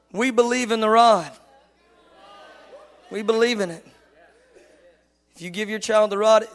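ordinary delivery

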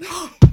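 sharp gasp of mock horror; loud shocked gasp. Real, not acted. Reacting to juice line.

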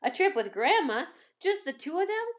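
ordinary delivery, nothing marked

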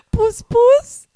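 high, loud, giggling; laughing squeal. Real, not acted. Playing with the word.

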